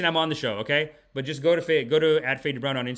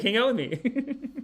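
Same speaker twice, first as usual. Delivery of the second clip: pleading uncle; 11 semitones higher, slower than usual. Retold or imitated. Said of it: "he was like".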